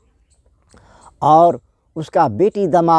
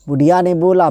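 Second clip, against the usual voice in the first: ordinary delivery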